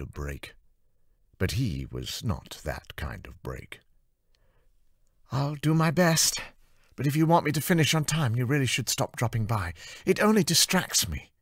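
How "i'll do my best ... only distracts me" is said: put-on somewhat higher, strained young woman; firm but worn; tired artist. Anna defending her deadline to Amy, tired and firm.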